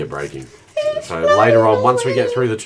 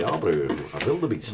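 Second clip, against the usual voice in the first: put-on South African accent; 4 dB quieter than usual. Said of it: "I can actually do a pretty good South African accent".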